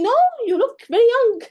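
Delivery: put-on loud, high gushing "no, you look very young"; overexcited flattery. Shown as a demonstration. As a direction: gush it out, way too excited. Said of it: "Speak professional".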